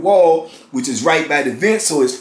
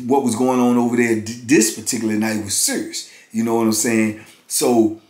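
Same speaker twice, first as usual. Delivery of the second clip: usual speaking voice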